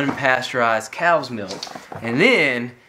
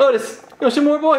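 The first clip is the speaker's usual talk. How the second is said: dog-talk voice; 10 semitones higher, faster than usual. Real, not acted. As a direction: tempt the dog with more. Offering the dog more.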